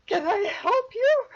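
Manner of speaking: high, startled servant woman's voice; a frightened maid peeking out at strangers; frazzled servant. Acted. Servant opening door to the adventurers, high and startled. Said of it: "looks past you ... and goes".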